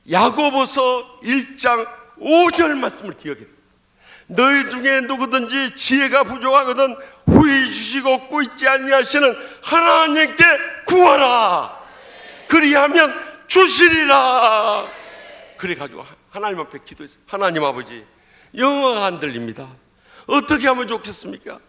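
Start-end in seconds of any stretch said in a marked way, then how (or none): 0.1-14.9 slow, loud, high preaching of scripture; preaching shout. Acted. 성경 구절을 외치며 설교. 17.3-21.6 fervent prayer. Retold or imitated. 같은 기도를 크게 외침.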